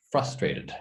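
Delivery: "Frustrated" with stress on FRUS; stress on first syllable. Shown as a demonstration. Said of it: "the accent on the first syllable".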